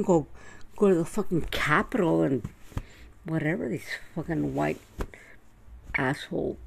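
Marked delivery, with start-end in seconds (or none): none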